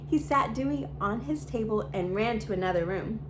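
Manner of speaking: plain reading voice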